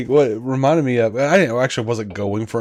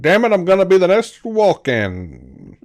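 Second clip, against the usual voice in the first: mock actor voice; 7 semitones higher, 3 dB louder than usual. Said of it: "He's like".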